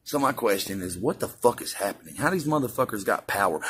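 ordinary talk

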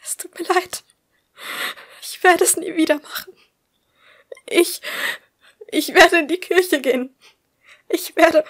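high, loud frantic bargaining; desperate pleading. Acted. Bleeding woman bargaining with God, desperately pleading. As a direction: beg in mounting panic, voice pitched high.

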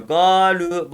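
"Ga, lü" with both vowels held long; steady, stretched vowels on "Ga, lü"; lengthened vowels. Shown as a demonstration. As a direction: draw out the a and then the ü in "Ga, lü". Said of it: "Vav uzattı".

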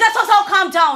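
high, loud call over the noise. Acted. Doctor raising her voice over quarrelling couples, loud. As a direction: shout them down with a laugh.

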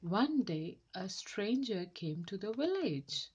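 plain reading voice

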